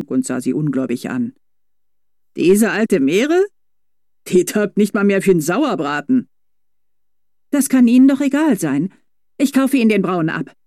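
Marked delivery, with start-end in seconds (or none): 2.4-6.3 scoffing. A narrator's reading. Driver scoffs at offer. 7.5-10.5 high, firm determined voice. A narrator's reading. Felicitas insisting on buying the horse, determined tone. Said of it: "mit einer Bestimmtheit in der Stimme".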